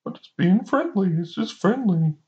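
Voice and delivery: high-pitched put-on dopey excuse; mocking their excuse. T T S A excusing backhanded compliments, high mocking voice. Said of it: "that's when they say".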